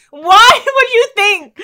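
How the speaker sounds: loud, high, drawn-out indignation; mock outrage. Retelling her reaction to a stranger, mock outrage. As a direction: wail it in comic disbelief.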